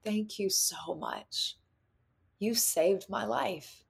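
soft, slow, tender thanks; hushed and sincere; heartfelt gratitude. Retold or imitated. Retold heartfelt thanks to child self.

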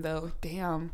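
normal talking voice

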